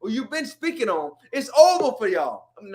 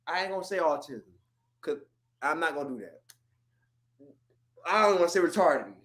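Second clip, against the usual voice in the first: muttered aside; 8 semitones lower, 5 dB quieter, faster than usual. Real, not acted. Muttered self-censoring aside.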